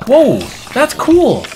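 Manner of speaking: loud, high-pitched whoop; amazed excitement. Real, not acted. Gamer discovering something cool, thrilled.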